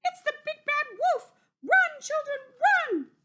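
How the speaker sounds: very high, loud squawking alarm; panicked hen. Acted. Missus Chicken yells at her chicks to run, very high and loud. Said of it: "squawked Missus Chicken".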